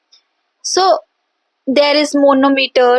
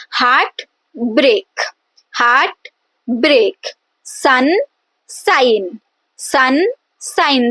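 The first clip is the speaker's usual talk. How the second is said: "Heart break" and "Sun, sign" as separate, evenly stressed beats; two equal stresses; much slower than usual. Shown as a demonstration. Teacher leading students to pronounce along, two separate stresses. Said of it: "You also pronounce that with me".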